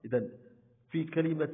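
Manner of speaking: ordinary talk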